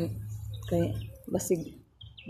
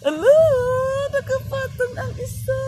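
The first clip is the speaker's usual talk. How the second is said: excited call; 13 semitones higher, 9 dB louder than usual. Real, not acted. Excited sighting of peacock.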